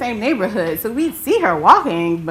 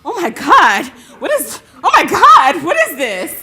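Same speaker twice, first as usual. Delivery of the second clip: panicked pleading; 9 semitones higher, 10 dB louder than usual. Retold or imitated. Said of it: "And just wailing".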